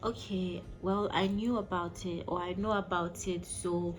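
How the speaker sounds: ordinary talk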